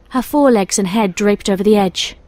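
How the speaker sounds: plain reading voice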